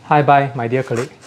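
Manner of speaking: ordinary talk, nothing marked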